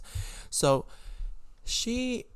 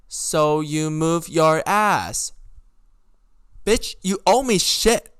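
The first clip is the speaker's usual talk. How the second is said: loud, high, drawn-out outburst; angry ranting; 5 semitones higher, 7 dB louder, much slower than usual. Retold or imitated. Podcaster yelling back at Vicky's friend about the charger, angry. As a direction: drag the words out in loud indignation.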